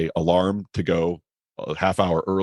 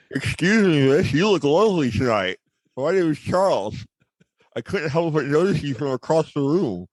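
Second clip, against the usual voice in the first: pencil in mouth; 8 semitones higher than usual. Pretend. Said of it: "I'll simulate".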